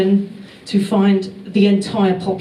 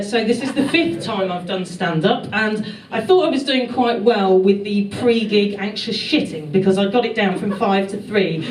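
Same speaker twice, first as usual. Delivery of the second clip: normal talking voice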